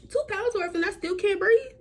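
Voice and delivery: high, incredulous rising question; retold disbelief. Vlogger replaying her reaction to the surgeon's claim, incredulous.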